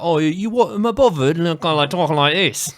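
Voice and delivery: loud, high mock Cockney accent. Shown as a demonstration. Showing the blend behind Aussie speech, mock Cockney accent. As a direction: push out a stock Cockney voice. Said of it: "like, Cockney English and Irish".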